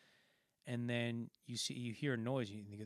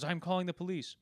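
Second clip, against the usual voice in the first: higher, louder imitation of a threat; mock outrage; 6 semitones higher, 5 dB louder than usual. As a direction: play an indignant man threatening. Acted angry shopkeeper.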